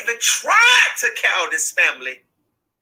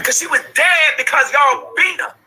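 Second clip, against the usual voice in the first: raised, accusing shout; furious shout; 6 semitones higher than usual. Real, not acted. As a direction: hurl the accusation at them. Mother accuses the families again.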